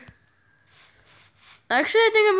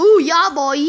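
excited whoop; 4 semitones higher, 7 dB louder than usual. Real, not acted. Big explosion in game.

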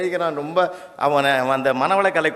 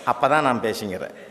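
ordinary delivery

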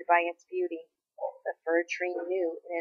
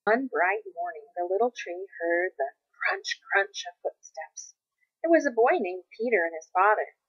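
plain reading voice

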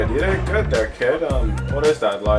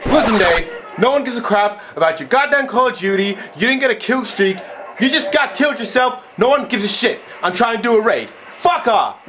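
raging gamer; 9 semitones higher than usual. Acted. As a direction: shout him down in a rage. Yelling at friend over game.

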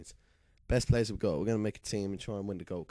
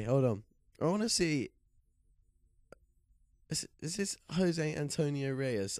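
ordinary delivery

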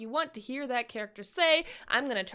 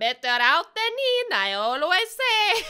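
put-on Shrek accent; 3 semitones higher, 4 dB louder than usual. Shrek quote.

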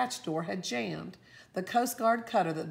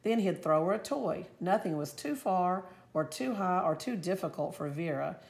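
ordinary delivery, nothing marked

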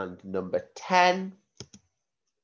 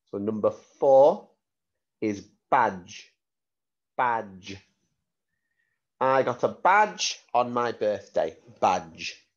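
clear, careful word-sentence-word dictation. Shown as a demonstration. Spelling test dictation.

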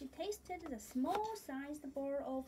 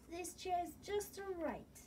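put-on higher, pleased little girl voice; Goldilocks pleased; 6 semitones higher than usual. Goldilocks on the small chair, pleased and higher. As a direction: sound delighted it finally fits.

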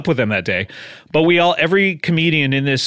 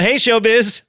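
raised, higher playful hail; mock calling out; 7 semitones higher, slower than usual. Calling to show business from a foot in the door, mock hail. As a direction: call out as a pretend greeting.

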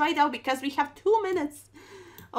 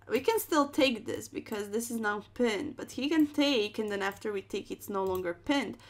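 usual speaking voice

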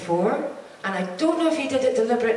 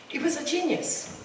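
filling up; 4 semitones higher, 4 dB quieter than usual. Real, not acted. Remembering the late John Hurt, voice catching with tears. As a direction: voice catching with real emotion. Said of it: "I'm filling up with this".

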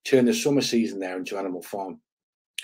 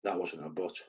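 much softer, calm and unbothered; 3 semitones lower, 12 dB quieter than usual. Retold or imitated. Doorman answering the headbutt, in a calm soft voice. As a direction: say it quietly, almost gently, as a warning. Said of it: "calmly said in a soft voice".

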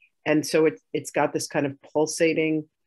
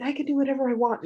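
higher, fast breathless whisper; defiant whisper; 9 semitones higher, faster than usual. Retold or imitated. Girl hissing back at her scolding mother, fast whisper.

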